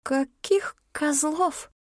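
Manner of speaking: slow, high, baffled question. A narrator's reading. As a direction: drag it out in disbelief. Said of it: "даже самообладание эльфийки не выдержало".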